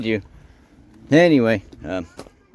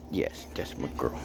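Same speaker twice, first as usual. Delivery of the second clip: whispered praise; 8 semitones lower, 12 dB quieter than usual. Real, not acted.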